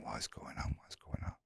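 a whispered worried inner voice; whispered thought. Retold or imitated. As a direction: whisper it to yourself, anxious. Anxious inner thoughts in cell.

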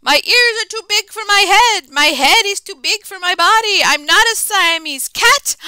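put-on high, loud Spanish accent. Kitten trying his best Spanish accent, high and loud. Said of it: "using his very best Spanish accent".

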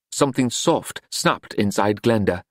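plain reading voice

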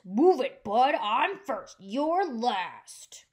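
loud bossy truck, slow on I'm first; grocery truck bossing others off the road; pushy shout. Acted. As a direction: blast it out like a horn. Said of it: "gave his horn a blast".

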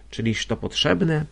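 ordinary delivery

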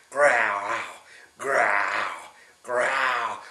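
low mock bear growl. Grandpa growling as the dancing bears. Bears making their special sound.

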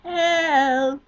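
loud, high mock call for help; mock cry for help. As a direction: call for help in hammed-up panic.

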